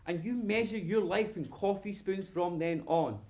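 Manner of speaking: ordinary talk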